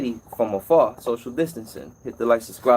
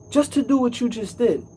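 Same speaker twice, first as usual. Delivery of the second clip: high, put-on voice of a huffy customer; indignant customer; 10 semitones higher than usual. Customer complaining about a short visit, high mock huff. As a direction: mimic her scoffing at a quick fix. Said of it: "And she's like".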